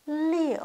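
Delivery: « Liu » haut et fort qui tombe; « Liu » lancé puis descendant; liu quatrième ton. Shown as a demonstration. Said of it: "Quatrième ton". (liu au ton descendant)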